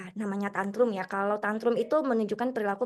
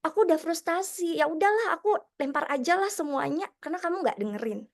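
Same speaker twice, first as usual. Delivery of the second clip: put-on frustrated child; 6 semitones higher than usual. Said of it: "Jadi murid tuh juga sama".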